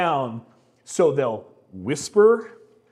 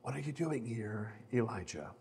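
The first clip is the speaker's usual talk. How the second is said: very soft, low, hushed voice; gentle whisper; 5 semitones lower, 14 dB quieter than usual. Acted. God's voice calling to Elijah at the cave, gentle whisper. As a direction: speak gently, barely above a whisper. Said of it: "the sound of a gentle whisper".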